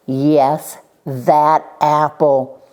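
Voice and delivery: loud, slow, emphatic confirmation; weighty stress on each word; firm insistence. Acted. Schubert answers Benny's question, loudly insistent.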